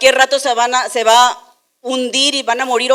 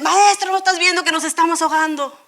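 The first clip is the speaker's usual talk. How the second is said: much higher, fast and urgent; panicked plea; 6 semitones higher than usual. Retold or imitated. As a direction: blurt it out in alarm. Disciples waking Jesus, panicked.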